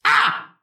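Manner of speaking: sharp high yelp of pain; pained cry. Acted. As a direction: cry out as if hit by an arrow. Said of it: "Du hörst".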